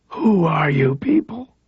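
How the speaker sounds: high, strained rasp of a weak man; high rasping voice of a paralysed man. A narrator's reading. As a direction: rasp it out, high and thin, barely any breath. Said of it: "Cross rasped from the screen".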